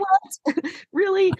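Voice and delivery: high, squeaky disbelief; mock surprise. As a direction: gasp out a high what. Reaction to the writers' strike.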